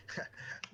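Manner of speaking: quiet, breath-only acted chuckle; brief low-volume snicker in character; soft chuckle. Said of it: "you hear a chuckle in your head".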